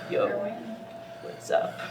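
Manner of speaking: very low, soft yo; imitated deep voice. Girlfriend corrects his version of the greeting, deep voice. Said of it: "you were all deep".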